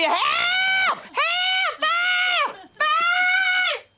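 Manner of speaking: very high, loud acted screams; screaming for help. People seeing a fire, screaming for help. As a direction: scream the alarm at full pitch, long drawn out.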